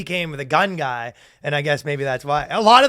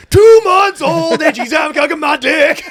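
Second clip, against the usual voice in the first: laughing shout; 10 semitones higher, 9 dB louder than usual. Retold or imitated. Quoting raunchy lyric, laughing shout.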